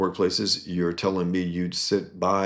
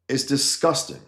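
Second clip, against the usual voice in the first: ordinary delivery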